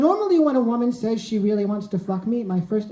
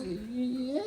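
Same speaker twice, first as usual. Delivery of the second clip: meek yes; 11 dB quieter than usual. Retold or imitated. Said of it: "what came out was".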